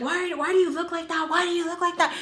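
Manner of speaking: high, insistent imitation of annoyance; annoyed questioning. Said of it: "So she's getting annoyed".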